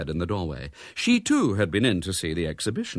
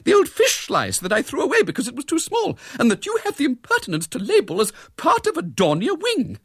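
high, loud, relentless tirade; prim, high-pitched outrage; grim indignation; 5 dB louder than usual. A narrator's reading. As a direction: list each offence with mounting scorn. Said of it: "said Miss Milton grimly".

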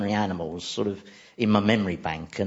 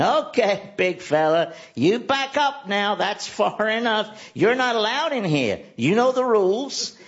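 soothing, friendly; 9 semitones higher than usual. Retold or imitated. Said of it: "talking to it in a very non-threatening, friendly way".

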